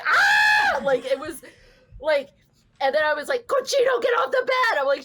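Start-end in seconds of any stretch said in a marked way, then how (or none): 0.1-0.8 sudden high yelp of shock; short high shriek; startled yelp. Retold or imitated. Cat pees in her lap. 3.5-4.8 sharp high scolding yell; scolding shout. Retold or imitated. Scolding cat who peed.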